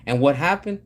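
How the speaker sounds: usual speaking voice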